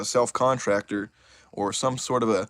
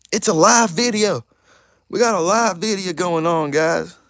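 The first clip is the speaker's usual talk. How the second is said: excited hype; 9 semitones higher, 6 dB louder than usual. Real, not acted. Hyping the live stream.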